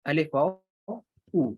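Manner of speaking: slow, letter by letter: الف, واو, then a long او as oo; spelled-out vowel demo. Shown as a demonstration. Teacher spelling out the pesh sound for students, slowly. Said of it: "او کی آواز دے گا".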